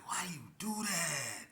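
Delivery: slow, whispered reproach; a worried man whispering to a friend. Retold or imitated. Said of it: "He was like".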